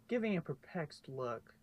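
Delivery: ordinary delivery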